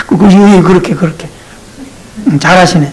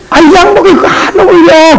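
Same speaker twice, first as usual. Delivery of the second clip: high laughing mock critic; mocking a deacon's suit, laughing and high; picky critic; 8 semitones higher than usual. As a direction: tease the suit's fit. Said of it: "그러지 마".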